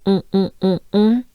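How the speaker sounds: "On" said as a French nasal vowel, held and repeated; nasal on sound. Shown as a demonstration. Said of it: "That funny sound".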